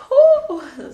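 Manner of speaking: loud, high "whew"; overwhelmed whoop. Real, not acted. Overcome by emotion mid-reading.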